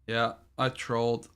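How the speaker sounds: ordinary talk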